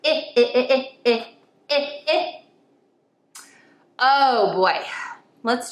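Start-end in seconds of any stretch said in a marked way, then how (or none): 0.0-2.4 short vowel I repeated crisply; short i sound. Shown as a demonstration. Teacher modeling the short I sound for kids. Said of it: "the short vowel sound for the letter I".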